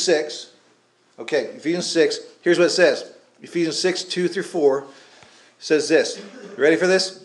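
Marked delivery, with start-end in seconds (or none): none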